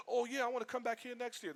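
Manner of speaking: high-pitched eager visitor; mock keen. Visitor telling Microsoft staff he'll return, mock keen.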